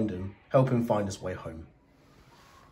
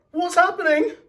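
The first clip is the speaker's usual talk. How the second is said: much higher alarmed squeak; 3 dB louder than usual. Acted. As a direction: squeak it like a tiny scared toothbrush. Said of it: "he squeaked".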